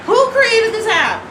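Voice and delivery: who on earth made this; outraged. Real, not acted. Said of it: "outraged at the app".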